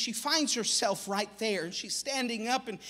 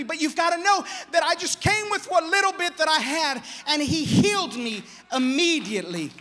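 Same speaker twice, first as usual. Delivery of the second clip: much louder and higher, pleading; loud plea; 8 semitones higher, 8 dB louder than usual. Acted. Woman pleading before the people, loud and high. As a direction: cry it out to the crowd.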